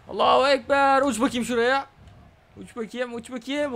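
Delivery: high, loud playful cheer; excited mock yell. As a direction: hype up the move. Yelling in play.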